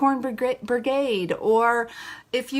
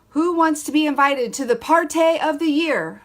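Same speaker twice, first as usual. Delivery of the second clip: louder, higher mock sales pitch; scripted party pitch; 4 semitones higher, 6 dB louder than usual. Coach mocking a script read to a friend, scripted pitch. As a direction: announce it like a scripted invitation.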